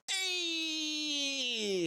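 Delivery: long, very high greeting holler; high-pitched hey, held long; high drawn-out call. Acted. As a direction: stretch it out high in a cheery call. Player calls out to mascot.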